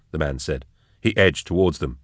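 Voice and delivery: ordinary delivery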